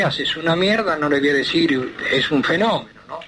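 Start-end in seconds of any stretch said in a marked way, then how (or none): none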